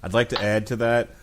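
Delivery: ordinary delivery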